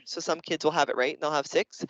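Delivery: normal talking voice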